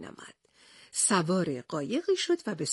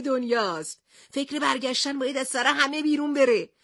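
raised, higher captain voice; captain shouting; 6 semitones higher than usual. A narrator's reading. The captain shouts at his companions. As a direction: Haddock calling out to the crew. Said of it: "کاپیتان هادوک داد زد".

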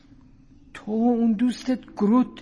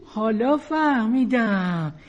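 usual speaking voice

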